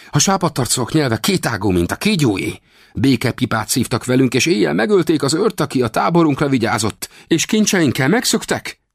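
high-pitched accusing warrior voice; high, emphatic denunciation; indignant Comanche warrior. Acted. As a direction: voice an aggrieved warrior, pitched well up.

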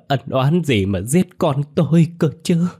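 high, loud grief-stricken wail; wailing cry. Acted. Mother wails at her son's killer, high and loud. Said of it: "mà gào lên".

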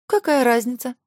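high surprised question; surprised, voice jumping up. A narrator's reading. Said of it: "удивилась Зоя". (удивлённо, высоко)

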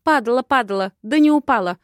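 high, loud dismissive comeback; snorting retort. A narrator's reading. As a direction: huff it back defiantly. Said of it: "затем фыркнула".

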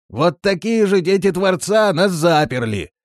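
high, loud complaint; aggrieved shouting. A narrator's reading. Trapped villager blaming the Creator's children, shouting. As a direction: call it out through a closed hatch. Villager calls from locked cellar.

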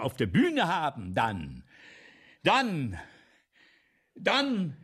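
loud, high pleading that builds and stalls on the repeated dann; building insistent plea. Retold or imitated. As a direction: pour it out, then get stuck on each dann. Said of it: "sage ich".

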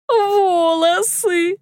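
high, slow squeal; high drawn-out squeak. A narrator's reading. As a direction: wail the word out high. Dunka blurts out.

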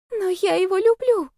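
high, small plaintive voice; plaintive girl. A narrator's reading. Poor girl confesses love to roommate, plaintive and high. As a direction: confess it helplessly, pitched high. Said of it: "прошептала бедолага".

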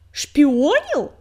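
spat out, loud and outraged; indignant. A narrator's reading. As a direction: spit the word back in disbelief. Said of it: "возмущённо выплюнул".

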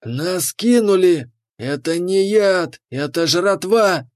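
high, drawn-out indignant cries; cheated lizard bellowing slowly; outraged roar. A narrator's reading. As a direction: rouse the gang against the cheat, slowly and high. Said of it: "взревел ящер".

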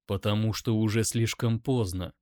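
ordinary delivery

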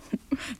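brief mocking laugh; teasing little laugh; short laugh. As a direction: laugh at him lightly. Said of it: "jetzt machst du dich lustig".

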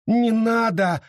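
high-pitched begging cry; a mother pleading in alarm; desperate plea. A narrator's reading. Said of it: "взмолилась Энн".

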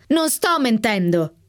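outburst. A narrator's reading.

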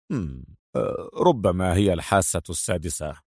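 low, soft, musing with a hum; smiling evasion. A narrator's reading. Adham dodging the inspector's question, smiling. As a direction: hedge softly with a slight smile. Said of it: "ابتسم أدهم وقال".

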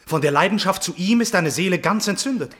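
a stepson's shocked cry; shocked outburst. Acted. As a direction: rise up in disbelief. Stepson shocked by her passion.

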